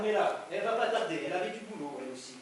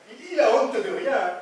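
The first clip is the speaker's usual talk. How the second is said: slow, loud, laughing it out; can't believe his nerve, laugh through it; laughing in disbelief; 7 semitones higher, 9 dB louder, slower than usual. Acted. To Carmela about her brother, a loud, slow disbelieving laugh.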